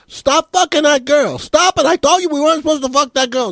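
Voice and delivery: high-pitched shout called from a height; acted yelling. Man up a tree scolding two below, acted yelling. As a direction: yell it down in a strained high voice. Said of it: "he starts yelling out".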